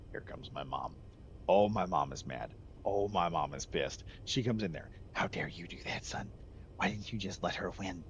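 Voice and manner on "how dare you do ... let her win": hissed, softer scolding as his mother; a retold mom's hushed angry scold; mock angry mom. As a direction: hiss it like a furious mother.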